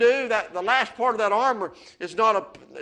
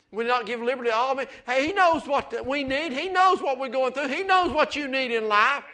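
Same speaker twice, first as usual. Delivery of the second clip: louder and higher with each line; preaching at full force; 7 semitones higher, 7 dB louder than usual. Acted. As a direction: drive it home to the congregation. Preacher climbing to climax.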